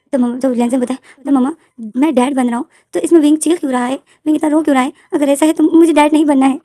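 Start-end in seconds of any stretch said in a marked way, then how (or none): 1.2-6.6 put-on high, loud whiny little boy; upset child. Little boy telling mama he won't be a dad, whiny and loud. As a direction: complain like a stubborn kid.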